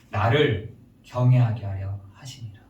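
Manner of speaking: low-pitched, deliberate quotation; God's answer. Acted. God's answer to the complaining believers, low solemn answer. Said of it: "양심의 말하기를 뭐라고 하는지".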